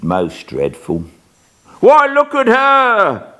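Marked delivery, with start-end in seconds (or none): 1.8-3.3 high, loud, slow panicked cry; terrified cry. Acted. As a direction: cry out at the ghost. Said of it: "he cries out".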